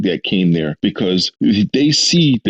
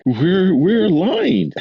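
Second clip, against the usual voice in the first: higher, slow triumphant mock line; mock Malcolm; 11 semitones higher, much slower than usual. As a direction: drag it out, pleased with himself. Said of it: "it's like".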